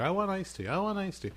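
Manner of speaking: imitated kid's high-pitched pleading; demanding toddler. Dad imitates son the entire ride home, demanding. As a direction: chant it like a child nagging from the back seat.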